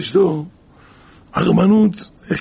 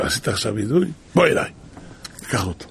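triumphant Satan; 5 semitones lower, 4 dB quieter, faster than usual. Retold or imitated. Satan reveals trick.

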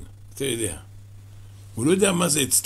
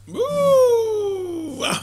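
long drawn wonder cry; much slower than usual. Acted. Storyteller marvels at riches.

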